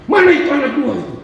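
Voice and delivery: high, fast acted yell; shouted question. Cousin pulls up looking for two kids, high hurried shout. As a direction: holler for the missing children, quick and high. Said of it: "Dia berteriak".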